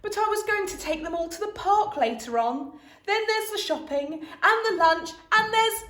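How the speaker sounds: lightly voiced tired mother; a hint of a worn-out mum; Mrs Large, weary. A narrator's reading. Mum telling dad she feels unwell, tired voice.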